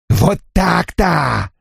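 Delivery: slow, gloating cry; triumphant exclaim. A narrator's reading. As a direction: savor the victory. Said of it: "победно воскликнул джентльмен".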